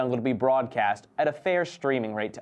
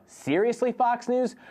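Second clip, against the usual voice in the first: high, mock-disbelieving; a pitched-up, exasperated "really?"; incredulous seriously; 8 semitones higher than usual. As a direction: scoff at them in disbelief. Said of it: "I am gonna give you a".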